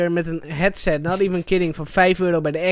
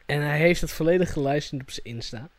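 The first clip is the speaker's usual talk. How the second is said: normal talking voice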